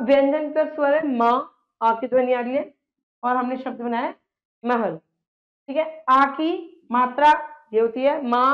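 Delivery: ordinary talk, nothing marked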